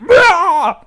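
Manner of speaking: loud, high wordless yell; gut-panic yell. Acted. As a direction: let out a sudden blaring "ah". Imitating the noise of the gut.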